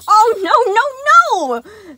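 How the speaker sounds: high, loud, near-crying no's; panicked yelp. A narrator's reading. Sun yelping over the knocked-down blocks. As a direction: yelp as if the world just ended. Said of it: "Sun yelped".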